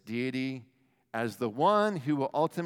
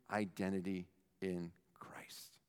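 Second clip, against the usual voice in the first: whisper; 8 semitones lower, 12 dB quieter than usual. Real, not acted. Dramatic whisper.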